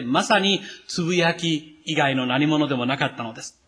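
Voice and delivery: ordinary talk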